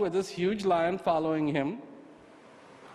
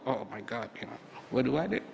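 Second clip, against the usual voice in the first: softer, fast panicky mutter; softer fast mock panic; 7 semitones lower, 6 dB quieter, faster than usual. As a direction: imitate a worried owner rushing. Mock panic over business.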